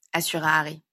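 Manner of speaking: plain reading voice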